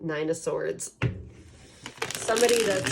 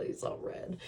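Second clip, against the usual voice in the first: soft near-whisper so the sleeping dog stays undisturbed; breathy whispered aside; hushed aside; 9 dB quieter than usual. Real, not acted. Dog asleep beside her.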